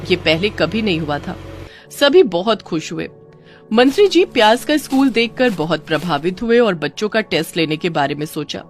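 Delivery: ordinary delivery, nothing marked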